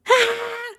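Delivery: a sudden high scream without added volume; retold scream. Woman recreating her scream to husband on the phone, high shriek. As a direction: shriek high as the ceiling comes down.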